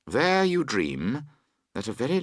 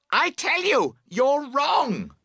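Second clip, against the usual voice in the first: high, loud screamed insistence; 5 dB louder, slower than usual. Acted. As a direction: scream it at the top of your lungs. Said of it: "you scream".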